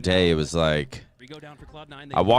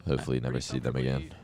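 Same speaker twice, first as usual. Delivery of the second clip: low, soft, deflated aside; awkward muttering; 5 semitones lower, 10 dB quieter, faster than usual. Retold or imitated. Man alone after elevator door closes, low awkward mutter. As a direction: trail away low, as if to yourself.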